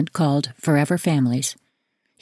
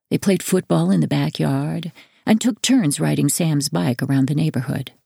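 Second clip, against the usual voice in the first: ordinary delivery, nothing marked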